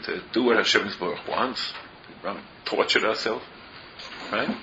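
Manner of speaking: ordinary delivery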